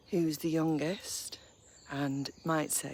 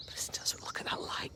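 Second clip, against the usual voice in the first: whisper; 7 dB quieter than usual. Real, not acted. Hushed in the woods.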